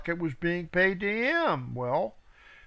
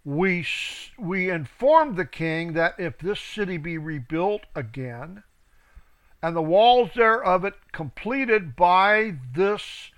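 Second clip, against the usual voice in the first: scripture reading. Acted. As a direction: read it out like a preacher. Reads scripture aloud.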